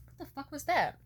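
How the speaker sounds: high, loud startled outburst. Real, not acted. Fly buzzes into her ear mid-story, real startled yelp. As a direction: jump at a fly buzzing in your ear. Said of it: "a fly just, like, flew directly into my ear".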